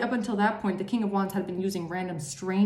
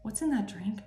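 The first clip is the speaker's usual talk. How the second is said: softer, bemused questioning; puzzled aside; 7 dB quieter than usual. Acted. Listener puzzling over the friends' weird talk, puzzled aside. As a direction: quietly question their odd behavior.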